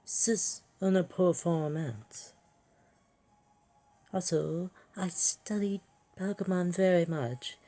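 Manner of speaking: grand, theatrical showoff with a put-on French accent; bad French accent. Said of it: "I am sorry to all French people".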